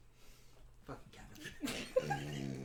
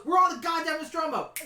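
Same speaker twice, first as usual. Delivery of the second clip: excited shout; 9 semitones higher, 4 dB louder than usual. Retold or imitated. Recalling character's shout.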